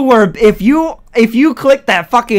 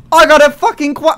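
voice cracking up an octave; 7 semitones higher, 4 dB louder than usual. Real, not acted. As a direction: voice cracks up high mid-word. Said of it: "sorry, my voice cracked".

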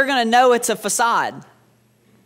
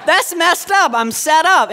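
loud, high mock protest; hammed-up outrage, loud and high; 9 semitones higher, 7 dB louder, slower than usual. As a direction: cry foul at being set up. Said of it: "my daughter went against me".

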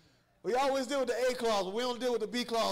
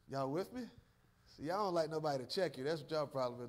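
ordinary talk

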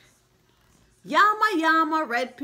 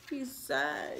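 usual speaking voice

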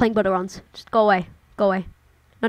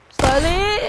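strained cry; 11 semitones higher, 4 dB louder than usual. Real, not acted. Desperate cry at game.

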